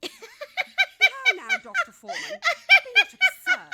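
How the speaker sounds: high, loud, laughing through the words; laughing haughty scoff. Acted. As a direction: scoff haughtily while cracking up.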